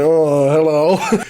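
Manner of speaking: very slow, sinister drawn-out hello; Bond villain greeting. Retold or imitated. Stranger at the door greets the startled girl, slow villain purr. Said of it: "Like a Bond villain".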